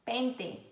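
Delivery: "pente" with clean "teh" ending; southern hard T. Shown as a demonstration. Teacher showing southern Brazil's way, "pente" with hard t. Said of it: "Eu acho que no sul".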